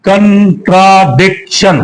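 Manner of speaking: Contradiction slowly in four beats, con, tra, dic, tion; slow, segmented contradiction; split into syllables. Shown as a demonstration. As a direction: break contradiction into four pieces with pauses. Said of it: "कितने टुकड़े हो गए".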